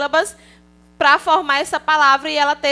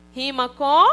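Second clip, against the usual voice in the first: ordinary delivery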